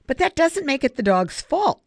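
sharply. A narrator's reading. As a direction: push back hard on the point. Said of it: "Savannah continued sharply".

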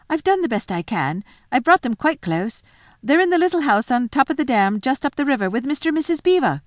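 high, rushed and pleading; a scared boy excusing himself; nervous boy voice. A narrator's reading.

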